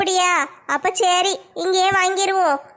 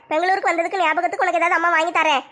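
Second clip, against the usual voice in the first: normal talking voice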